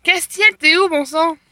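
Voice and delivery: calling out. Real, not acted. Searching the school, calling out for Castiel. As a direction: call for him across the school.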